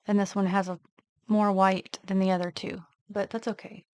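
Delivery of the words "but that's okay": ordinary talk, nothing marked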